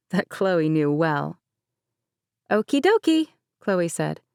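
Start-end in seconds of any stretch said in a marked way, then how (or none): 2.5-3.3 bright, high put-on cheer hiding worry; chipper tone. A narrator's reading. Chloe answers Granny with forced chipper cheer. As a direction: sound cheerful though she's uneasy. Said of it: "attempting a chipper tone".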